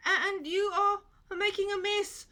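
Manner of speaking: high, loud grumbling complaint; complaining. A narrator's reading. Goat complaining about the mess, high and loud. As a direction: whine it as a cross goat. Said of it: "complained the goat".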